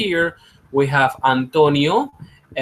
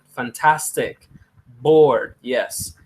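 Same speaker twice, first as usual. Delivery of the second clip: ordinary delivery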